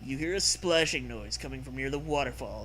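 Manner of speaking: normal talking voice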